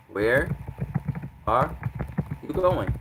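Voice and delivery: "Where", "are", "you going" separated by long pauses; emphatic word-by-word. Shown as a demonstration. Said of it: "to show emphasis".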